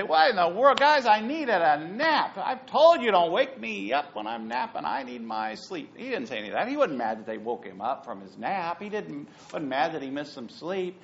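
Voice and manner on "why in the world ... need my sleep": higher, louder mock complaint about the nap; mock grumpy. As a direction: ham up a cranky sleeper. Mock grumpy Jesus, not real.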